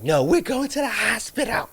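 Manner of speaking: high, insistent mock girlfriend voice; bossy girlfriend. Retelling how Hillary insisted on the hospital, high mock voice. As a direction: play her as the one who won't take no.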